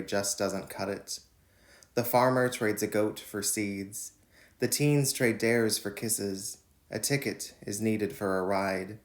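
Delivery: measured, deliberate poem reading; poetry reading. Acted. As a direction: read each line like a spoken poem. Reads his poem Barter.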